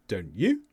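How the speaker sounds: "you" lifted at the end; unsure tag question, rising on "you"; rise on YOU. Shown as a demonstration. Teacher asks for an answer she lacks, "you" rising. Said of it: "Please give me the answer because I don't know".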